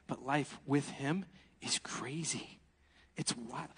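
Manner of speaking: slow, hushed whisper; slow secretive whisper to the congregation. Real, not acted. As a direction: lean in and whisper it slowly. Hushed aside in sermon.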